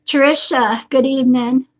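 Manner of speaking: ordinary talk, nothing marked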